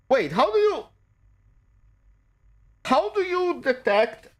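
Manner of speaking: high-pitched, baffled questioning; real confusion, voice jumping way up; puzzled disbelief. Real, not acted. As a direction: break off and ask it again, thrown and puzzled. Questions the guide he reads.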